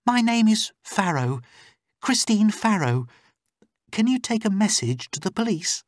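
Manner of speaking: very high, urgent female voice; high-pitched captive, earnest and urgent; pleading captive woman. Acted. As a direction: give your name and appeal for help. Captive reveals her name.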